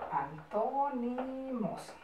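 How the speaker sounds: quiet, drawn-out syllables; slow soft dictation. Shown as a demonstration. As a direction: repeat it softly and slowly for copying. Teacher repeats dictated word softly. (dictado lento en voz baja)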